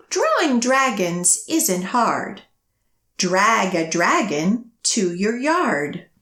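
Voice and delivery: lively picture-book storytelling; reading to children. A narrator's reading. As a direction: read it to a room of little kids, bouncing the rhyme. Reads new picture book aloud.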